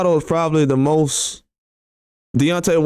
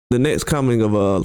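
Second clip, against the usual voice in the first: ordinary delivery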